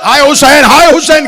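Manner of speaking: loud, soaring mourning recital; full-voiced lament for Hussain; impassioned majlis recitation. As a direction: declaim it to a grieving crowd.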